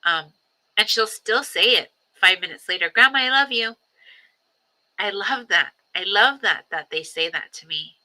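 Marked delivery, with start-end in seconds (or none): 2.9-3.8 put-on high, louder childlike voice; imitating little granddaughter. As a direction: mimic a small girl calling out from the back seat.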